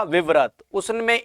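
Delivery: ordinary talk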